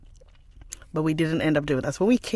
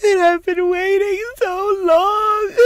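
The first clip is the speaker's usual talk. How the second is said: high, drawn-out mock wail; imitated crying child; 14 semitones higher, 6 dB louder, slower than usual. Mother imitating her son complaining about waiting, hammed-up crying. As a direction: bawl it slowly and loudly like a child. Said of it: "He burst into tears".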